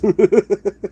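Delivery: short burst of high, loud laughter; real high laugh. Real, not acted. Laughing at the bar story.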